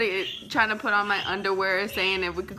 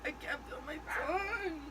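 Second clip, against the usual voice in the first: fake crying; 7 semitones higher, 13 dB quieter than usual. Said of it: "Damn, I'm a good actor".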